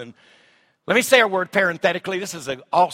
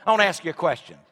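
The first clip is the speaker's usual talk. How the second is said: ordinary talk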